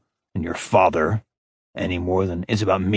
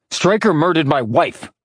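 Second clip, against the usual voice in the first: snarled; 6 semitones higher than usual. A narrator's reading. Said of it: "Orion snarled".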